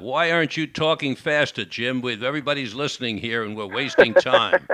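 hammed-up frustrated complaint; mock frustration. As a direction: take it out on him, playfully exasperated. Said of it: "you're frustrated and you take it out".

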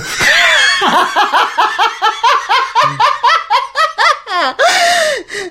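real burst of high laughter; big laugh. Real, not acted. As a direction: crack up at the punchline.

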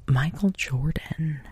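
hushed, awed reveal; telling mom who hit on her; whisper. Retold or imitated. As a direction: drop to a whisper for the big name. Telling mom the big secret.

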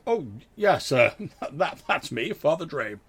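high, loud, chuckling vicar; a beaming village vicar greeting strangers; jolly vicar. Acted. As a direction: play the cheerful chubby priest. GM voices the chubby vicar.

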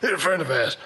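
bad Mafia accent that slips into Irish; hammy Mafia accent gone Irish; botched Mafia accent drifting Irish. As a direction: attempt a mobster accent, land somewhere near Irish. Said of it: "my Mafia accent was, like, Irish".

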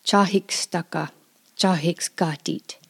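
Chahikstaka and chahikskatit with h, no R; Skeety dialect. Shown as a demonstration. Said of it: "the Skeety Band say things a little bit differently".

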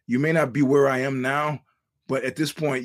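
normal talking voice

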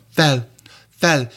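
mock Hungarian accent, like a glamorous sister act; made-up Hungarian accent. Shown as a demonstration. Linguist making up a Hungarian accent from film stars, mock Hungarian accent. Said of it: "make it up from Zsa Zsa Gabor and her sister".

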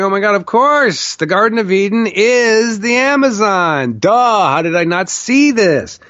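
high-pitched retold epiphany; laughing realisation. Man realising Eden is the Amazon, giddy revelation. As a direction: act out a giddy "it's so obvious" moment. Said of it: "And then I'm laughing".

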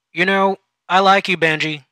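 boss voice. Acted. Supervisor turns friendly.

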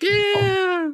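high, loud whoop of delight; excited cheer. Retold or imitated. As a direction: cheer like a kid seeing gore. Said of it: "and everyone's like".

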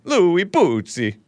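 slow, high mock Italian accent; stretched-out, sing-song fake Italian. Shown as a demonstration. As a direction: draw the name out in mock Italian. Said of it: "say it in a mock Italian accent".

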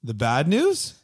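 The bad news? with a rising question on news; mock question tune. Shown as a demonstration. Cohost echoing the inflection as a question. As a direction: ask it back in a put-on voice. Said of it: "inflection in my voice".

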